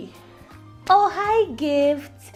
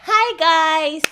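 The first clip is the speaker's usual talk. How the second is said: high, loud hello to followers; gushing livestream greeting; 3 semitones higher, 5 dB louder than usual. Acted. Sister opening her live for fans, gushing. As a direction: sing out a bright hello.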